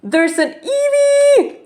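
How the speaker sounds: gasp, then high-pitched drawn-out squeal; real excitement. Real, not acted. Player spots a favorite Pokemon, real bursting excitement. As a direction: build from stunned gasp to very high delighted cry. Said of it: "you can imagine how excited I was".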